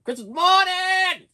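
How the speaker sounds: high, slow, stretched with wonder; excited drawn-out. Acted. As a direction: savor it like a thrilled kid. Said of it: "when you were a kid".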